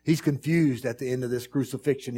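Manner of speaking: normal talking voice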